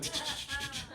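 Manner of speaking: soft rapid tongue clicks; quiet imitated calculator clicks; mental-math ticking. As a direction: tick like a child silently calculating. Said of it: "doing math in her head".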